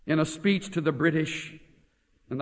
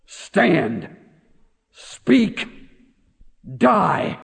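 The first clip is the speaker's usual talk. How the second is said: rallying command; 4 semitones higher, 5 dB louder, much slower than usual. Retold or imitated. Mordecai's charge to Esther.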